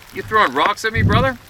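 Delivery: high, rising startled call down the creek; calling out, suddenly rattled. Retold or imitated. Narrator calling down to his friend, startled and high.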